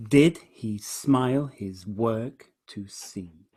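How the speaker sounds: slow line opening on stressed "Did"; deliberate verse, weight on "Did"; stress on first beat. Shown as a demonstration. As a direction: lean on "Did", measure out the rest. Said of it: "Emphasis on the first beat".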